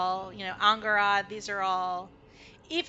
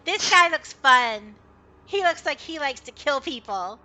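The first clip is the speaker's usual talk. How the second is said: higher, louder happy puppy voice; an excited dog bragging; eager dog; 4 semitones higher, 10 dB louder than usual. Acted. The good boy answers Kai, loud eager puppy voice.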